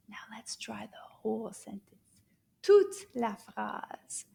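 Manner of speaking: whispered instruction. Shown as a demonstration. Teacher whispers to the class to try the sentence.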